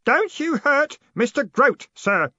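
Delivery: put-on high, loud, slow boyish warning; ringing voice. A narrator's reading. Stanley defending Grote from Moist, ringing out. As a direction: ring it out as a warning. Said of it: "said a ringing voice".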